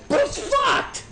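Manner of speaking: very high, strained falsetto; exasperated squeal. Acted. Exasperated climax of rant.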